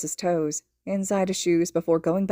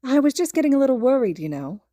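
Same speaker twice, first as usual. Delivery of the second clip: higher, louder, fast gentle mother; mother's voice; 7 semitones higher, 4 dB louder than usual. Acted. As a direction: chat eagerly like a fond mother on the phone. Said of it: "Inko is saying".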